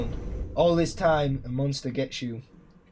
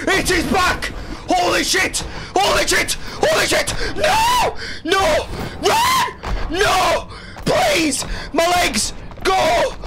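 very high, loud terrified shrieks; screaming in panic; 5 dB louder, slower than usual. Real, not acted. Trapped by the monster on the stairs, panicked screaming. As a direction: scream until your voice cracks. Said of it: "lightheaded from screaming".

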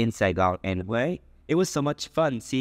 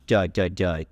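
mock shock; 4 semitones lower, much faster than usual. Shown as a demonstration. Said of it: "to emphasize how shocked".

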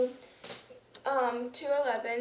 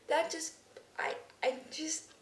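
ordinary delivery

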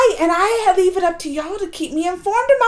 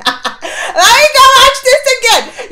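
higher, louder and slow, half crying; giddy laughing; 7 semitones higher, 8 dB louder, slower than usual. Real, not acted. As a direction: squeal it through laughter.

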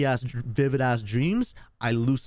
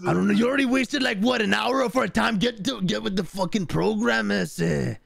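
heated gangster role voice, pitched up; angry gang member; 7 semitones higher than usual. Acted. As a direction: chew out your crew in character. RP gang character scolding partner.

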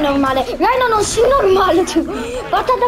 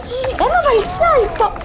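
higher, mock-suspense play call; excited call; 6 semitones higher than usual. Real, not acted. Gamer calls the enemy's coming jump, excited call. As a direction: lift the call high as the jump comes.

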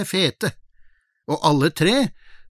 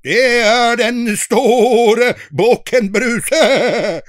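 slow, loud big goat voice; gruff big goat; 7 semitones higher, 5 dB louder, much slower than usual. Acted. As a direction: boom it out slowly like a big goat. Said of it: "Den var så grov i målet".

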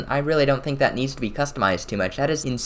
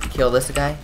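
ordinary talk, nothing marked

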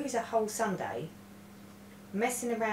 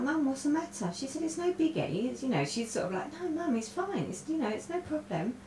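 higher, lightly voiced teen daughter, breezy; reassuring daughter; 9 semitones higher than usual. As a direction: play the daughter brushing it off, no biggie.

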